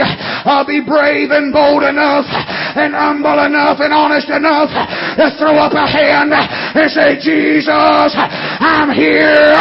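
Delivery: impassioned revival preaching with punched endings. Real, not acted. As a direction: drive each phrase home from the pulpit. Preaching at full force again.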